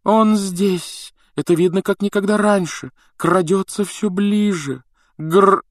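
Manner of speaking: high, drawn-out ominous wail; ominous seer. A narrator's reading. Трелони зловеще видит Грима. (зловеще, высоким голосом)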